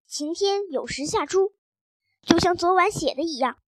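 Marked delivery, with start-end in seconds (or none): none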